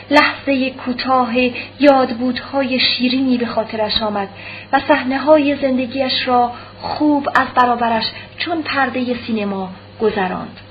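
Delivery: ordinary delivery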